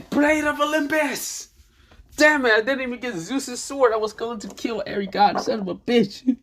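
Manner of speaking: very high cry, then ranting; frustrated yelling. Acted. Hero calling for a god's blade in vain, high frustrated yell.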